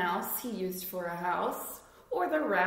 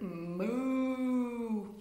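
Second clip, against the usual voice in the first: cow moo. Acted. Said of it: "who uttered".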